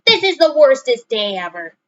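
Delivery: loud, high angry complaint; angry outburst. A narrator's reading. Franklin losing his temper at the pond. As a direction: lose your temper. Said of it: "Franklin lost his temper".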